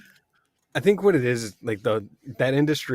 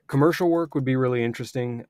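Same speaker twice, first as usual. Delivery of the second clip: ordinary talk, nothing marked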